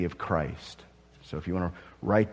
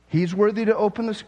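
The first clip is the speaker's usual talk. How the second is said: higher, louder confusion; can't square what he sees; puzzled questioner; 11 semitones higher, 4 dB louder than usual. Acted. John questions the elder about the lion, higher and puzzled.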